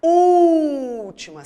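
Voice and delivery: ÚLTIMA with a long, loud, high Ú; exaggerated, held Ú in última; drawn-out stress. Shown as a demonstration. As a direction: stretch the first vowel of última right out, then drop. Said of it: "palavras que têm acento na".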